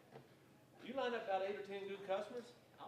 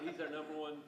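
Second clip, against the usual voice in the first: ordinary delivery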